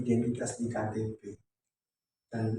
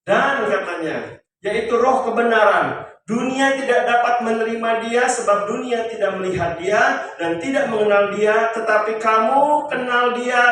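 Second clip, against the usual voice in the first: loud, raised preacher's voice; full-force preaching; 8 semitones higher, 11 dB louder than usual. Real, not acted. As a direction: proclaim the verse to the congregation. Preaches and reads verse forcefully. (berkhotbah lantang dengan nada tinggi)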